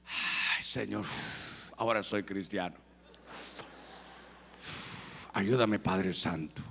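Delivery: deep, soft, dragged-out false piety; mock hypocrite prayer. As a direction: ham up a sanctimonious prayer. Mocking hypocritical smoker praying.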